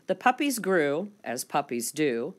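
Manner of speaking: ordinary delivery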